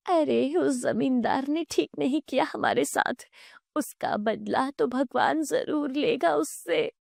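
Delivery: high-pitched wronged mother; aggrieved mother. Acted. Mother cursing the landlord who wronged her son, aggrieved.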